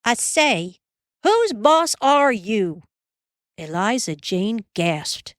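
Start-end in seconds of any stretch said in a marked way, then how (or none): 0.0-2.8 put-on a boy defying his bossy sister at full voice; shouted. A narrator's reading. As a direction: throw the words back at her. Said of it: "Almanzo straightened up and shouted".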